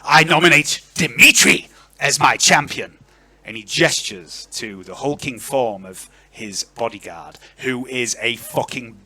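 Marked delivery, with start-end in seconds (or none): 0.0-3.0 loud, raised-pitch proclamation; forceful lordly announcement; yelling. Acted. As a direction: declare a champion to the hall. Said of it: "he yells".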